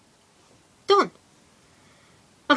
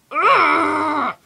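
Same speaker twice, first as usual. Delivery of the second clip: frustrated ugh; 2 semitones higher than usual. Real, not acted. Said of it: "Sorry, I'm just getting mad now".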